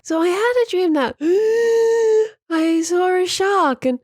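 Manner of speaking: high, slow, gasping wheeze; wheezy gasping. Retold or imitated. Mocking her wheezy morning dream recordings, high and gasping. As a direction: imitate waking up wheezy between words. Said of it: "I'll be like".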